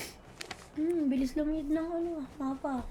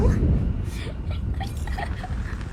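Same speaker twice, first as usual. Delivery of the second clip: whispered airy laugh; breathless laugh; 4 dB louder than usual. Real, not acted. Laughs after getting hit.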